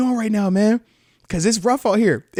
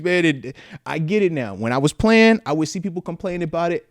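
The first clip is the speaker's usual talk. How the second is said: ordinary talk, nothing marked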